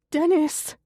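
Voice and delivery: high, imploring call; begging him by name; pleading. A narrator's reading. Connie to her husband at the stairs, pleading. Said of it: "Connie pleads".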